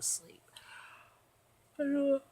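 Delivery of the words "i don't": ordinary talk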